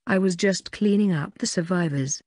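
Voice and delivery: usual speaking voice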